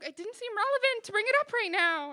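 high, loud defensive retort; defensive protest. Retold or imitated. Defensive in breakup quarrel.

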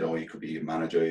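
ordinary talk, nothing marked

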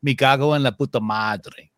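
Spain Spanish pronunciation, said firmly; Spanish phrase, plain first take; Spanish curse phrase. Shown as a demonstration. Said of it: "Here's a phrase that they say in Spanish".

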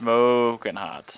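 SMOKING stretched out very slowly; "Smoking hot" drawn way out in relish; emphatic agreement. Real, not acted. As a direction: echo it with a long drawl on "Smoking". Echoes co-host's phrase in agreement.

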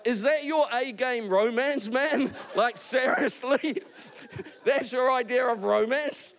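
high, laughing disbelief; incredulous laughing. Real, not acted. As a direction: laugh at how bad the line is. Laughing at the bad romance.

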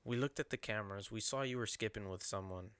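soft, hushed interrogator imitation; fake-nice coaxing. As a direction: play the nice principal quietly coaxing a confession. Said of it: "he's real nice".

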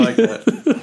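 laughing. Real, not acted. Laughing while echoing coach to co-host. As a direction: crack up echoing the coach line. Joining the Babcock impression.

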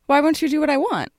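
sing-song sweet voice. Retold or imitated. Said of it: "in her sort of sing-song, sweet voice".

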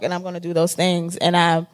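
usual speaking voice

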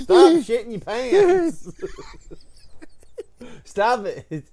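high, slow hammed-up scold of a child; mock scolding yell. Caretaker yelling at the boy who soiled himself, mock yell. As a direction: yell it like a fed-up caretaker, overplayed.

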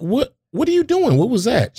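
high-pitched baffled questions; comically bewildered; retold bafflement. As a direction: act out startled confusion, voice jumping up. Retells bafflement at mother's sudden praying.

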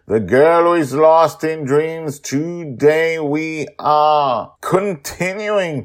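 upbeat show-host intro; podcast intro read. Acted. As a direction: present the show with energy.

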